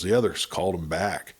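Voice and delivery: ordinary delivery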